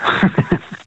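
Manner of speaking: short high chuckle; laugh. Real, not acted. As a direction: chuckle after an admission. Caller laughs after own admission.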